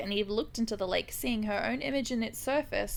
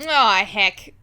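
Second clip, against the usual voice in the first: mock dismay; 9 dB louder than usual. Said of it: "And Gabriel is like".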